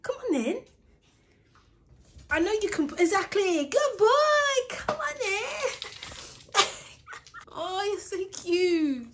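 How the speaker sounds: very high sing-song praise; high, loud cooing praise for the dog. Real, not acted. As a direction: gush over a good dog.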